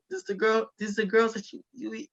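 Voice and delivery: high-pitched mock questioning; teasing high imitation of a mom; incredulous mom. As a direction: imitate a mom grilling her son, voice way up. Retold mom questioning her son.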